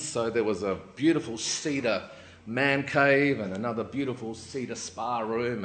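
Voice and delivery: ordinary talk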